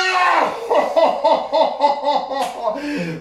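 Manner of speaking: loud, high whooping laugh; delighted laughing shout. Real, not acted. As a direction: burst out laughing and cheering. Son wins, father erupts. (głośny, radosny śmiech z okrzykiem)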